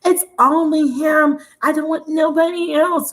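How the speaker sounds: mock clinging plea; mock clingy lover. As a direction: play a codependent lover who will only want him. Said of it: "that codependent".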